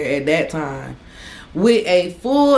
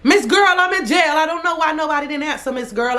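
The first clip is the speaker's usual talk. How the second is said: exasperated; 9 semitones higher than usual. Real, not acted. Exasperated at grandma.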